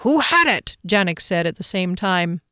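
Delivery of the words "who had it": high-pitched eager demand; excited question. A narrator's reading. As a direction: blurt it out, pitched up. Companion asks at same time.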